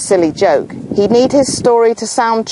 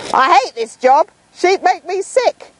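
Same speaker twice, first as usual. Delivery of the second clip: put-on higher, complaining kid's outburst; cross boy shouting; 10 semitones higher than usual. Bored shepherd boy complaining about his sheep. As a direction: whine it like a fed-up boy. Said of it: "cried out our shepherd".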